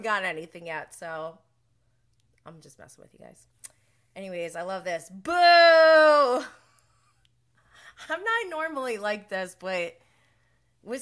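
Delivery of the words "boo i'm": loud jeering boo. Real, not acted. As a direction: boo him like a stadium crowd. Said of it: "Boo this man!".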